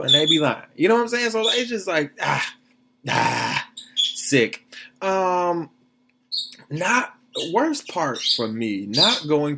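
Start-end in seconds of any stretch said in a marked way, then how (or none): none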